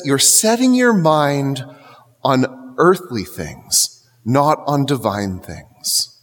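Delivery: slow, measured, falling; slow solemn quote. Retold or imitated. Preacher quoting Jesus to the congregation, slow and solemn. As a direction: lay each phrase down deliberately. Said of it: "Jesus says".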